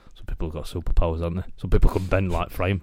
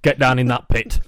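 mock shouting; 7 semitones higher, 8 dB louder than usual. Mock bossing the child.

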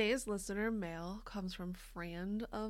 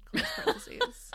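high, squeaky comic squeal on the last words; squealed punchline; 8 dB louder than usual. Pretend. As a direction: squeak it out like a gleeful joke.

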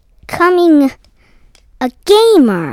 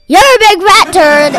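loud, higher gleeful jab; teasing shout; 6 semitones higher, 9 dB louder than usual. Real, not acted. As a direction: yell the insult with glee. Teasing insult to brother.

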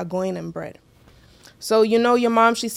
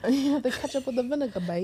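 a badly faked British accent, laughing; hammed-up London slang on the ketchup line; horrible British accent; 2 semitones higher, 5 dB quieter than usual. Friend mocking a British sister's way of talking, in a bad British accent. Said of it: "That's a horrible British accent".

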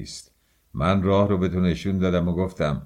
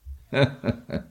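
short, high-pitched chuckle; acted chuckle; 6 semitones higher than usual. Character laughing at having caught the other hiding, chuckling. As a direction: let out a brief knowing laugh in character.

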